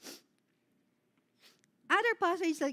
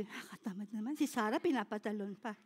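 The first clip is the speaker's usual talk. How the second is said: lazy grumble; 7 semitones lower, 8 dB quieter, faster than usual. Retold or imitated.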